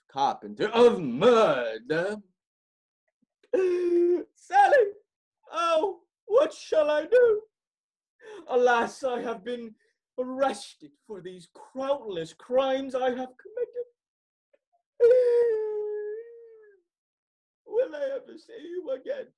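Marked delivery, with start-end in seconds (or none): 3.5-4.2 high theatrical gasp; dramatic gasp. Acted. Frank dramatically gasps as character. 4.3-19.3 high, loud, wailing tragic hero; melodramatic lament. Acted. Frank laments his arrest melodramatically.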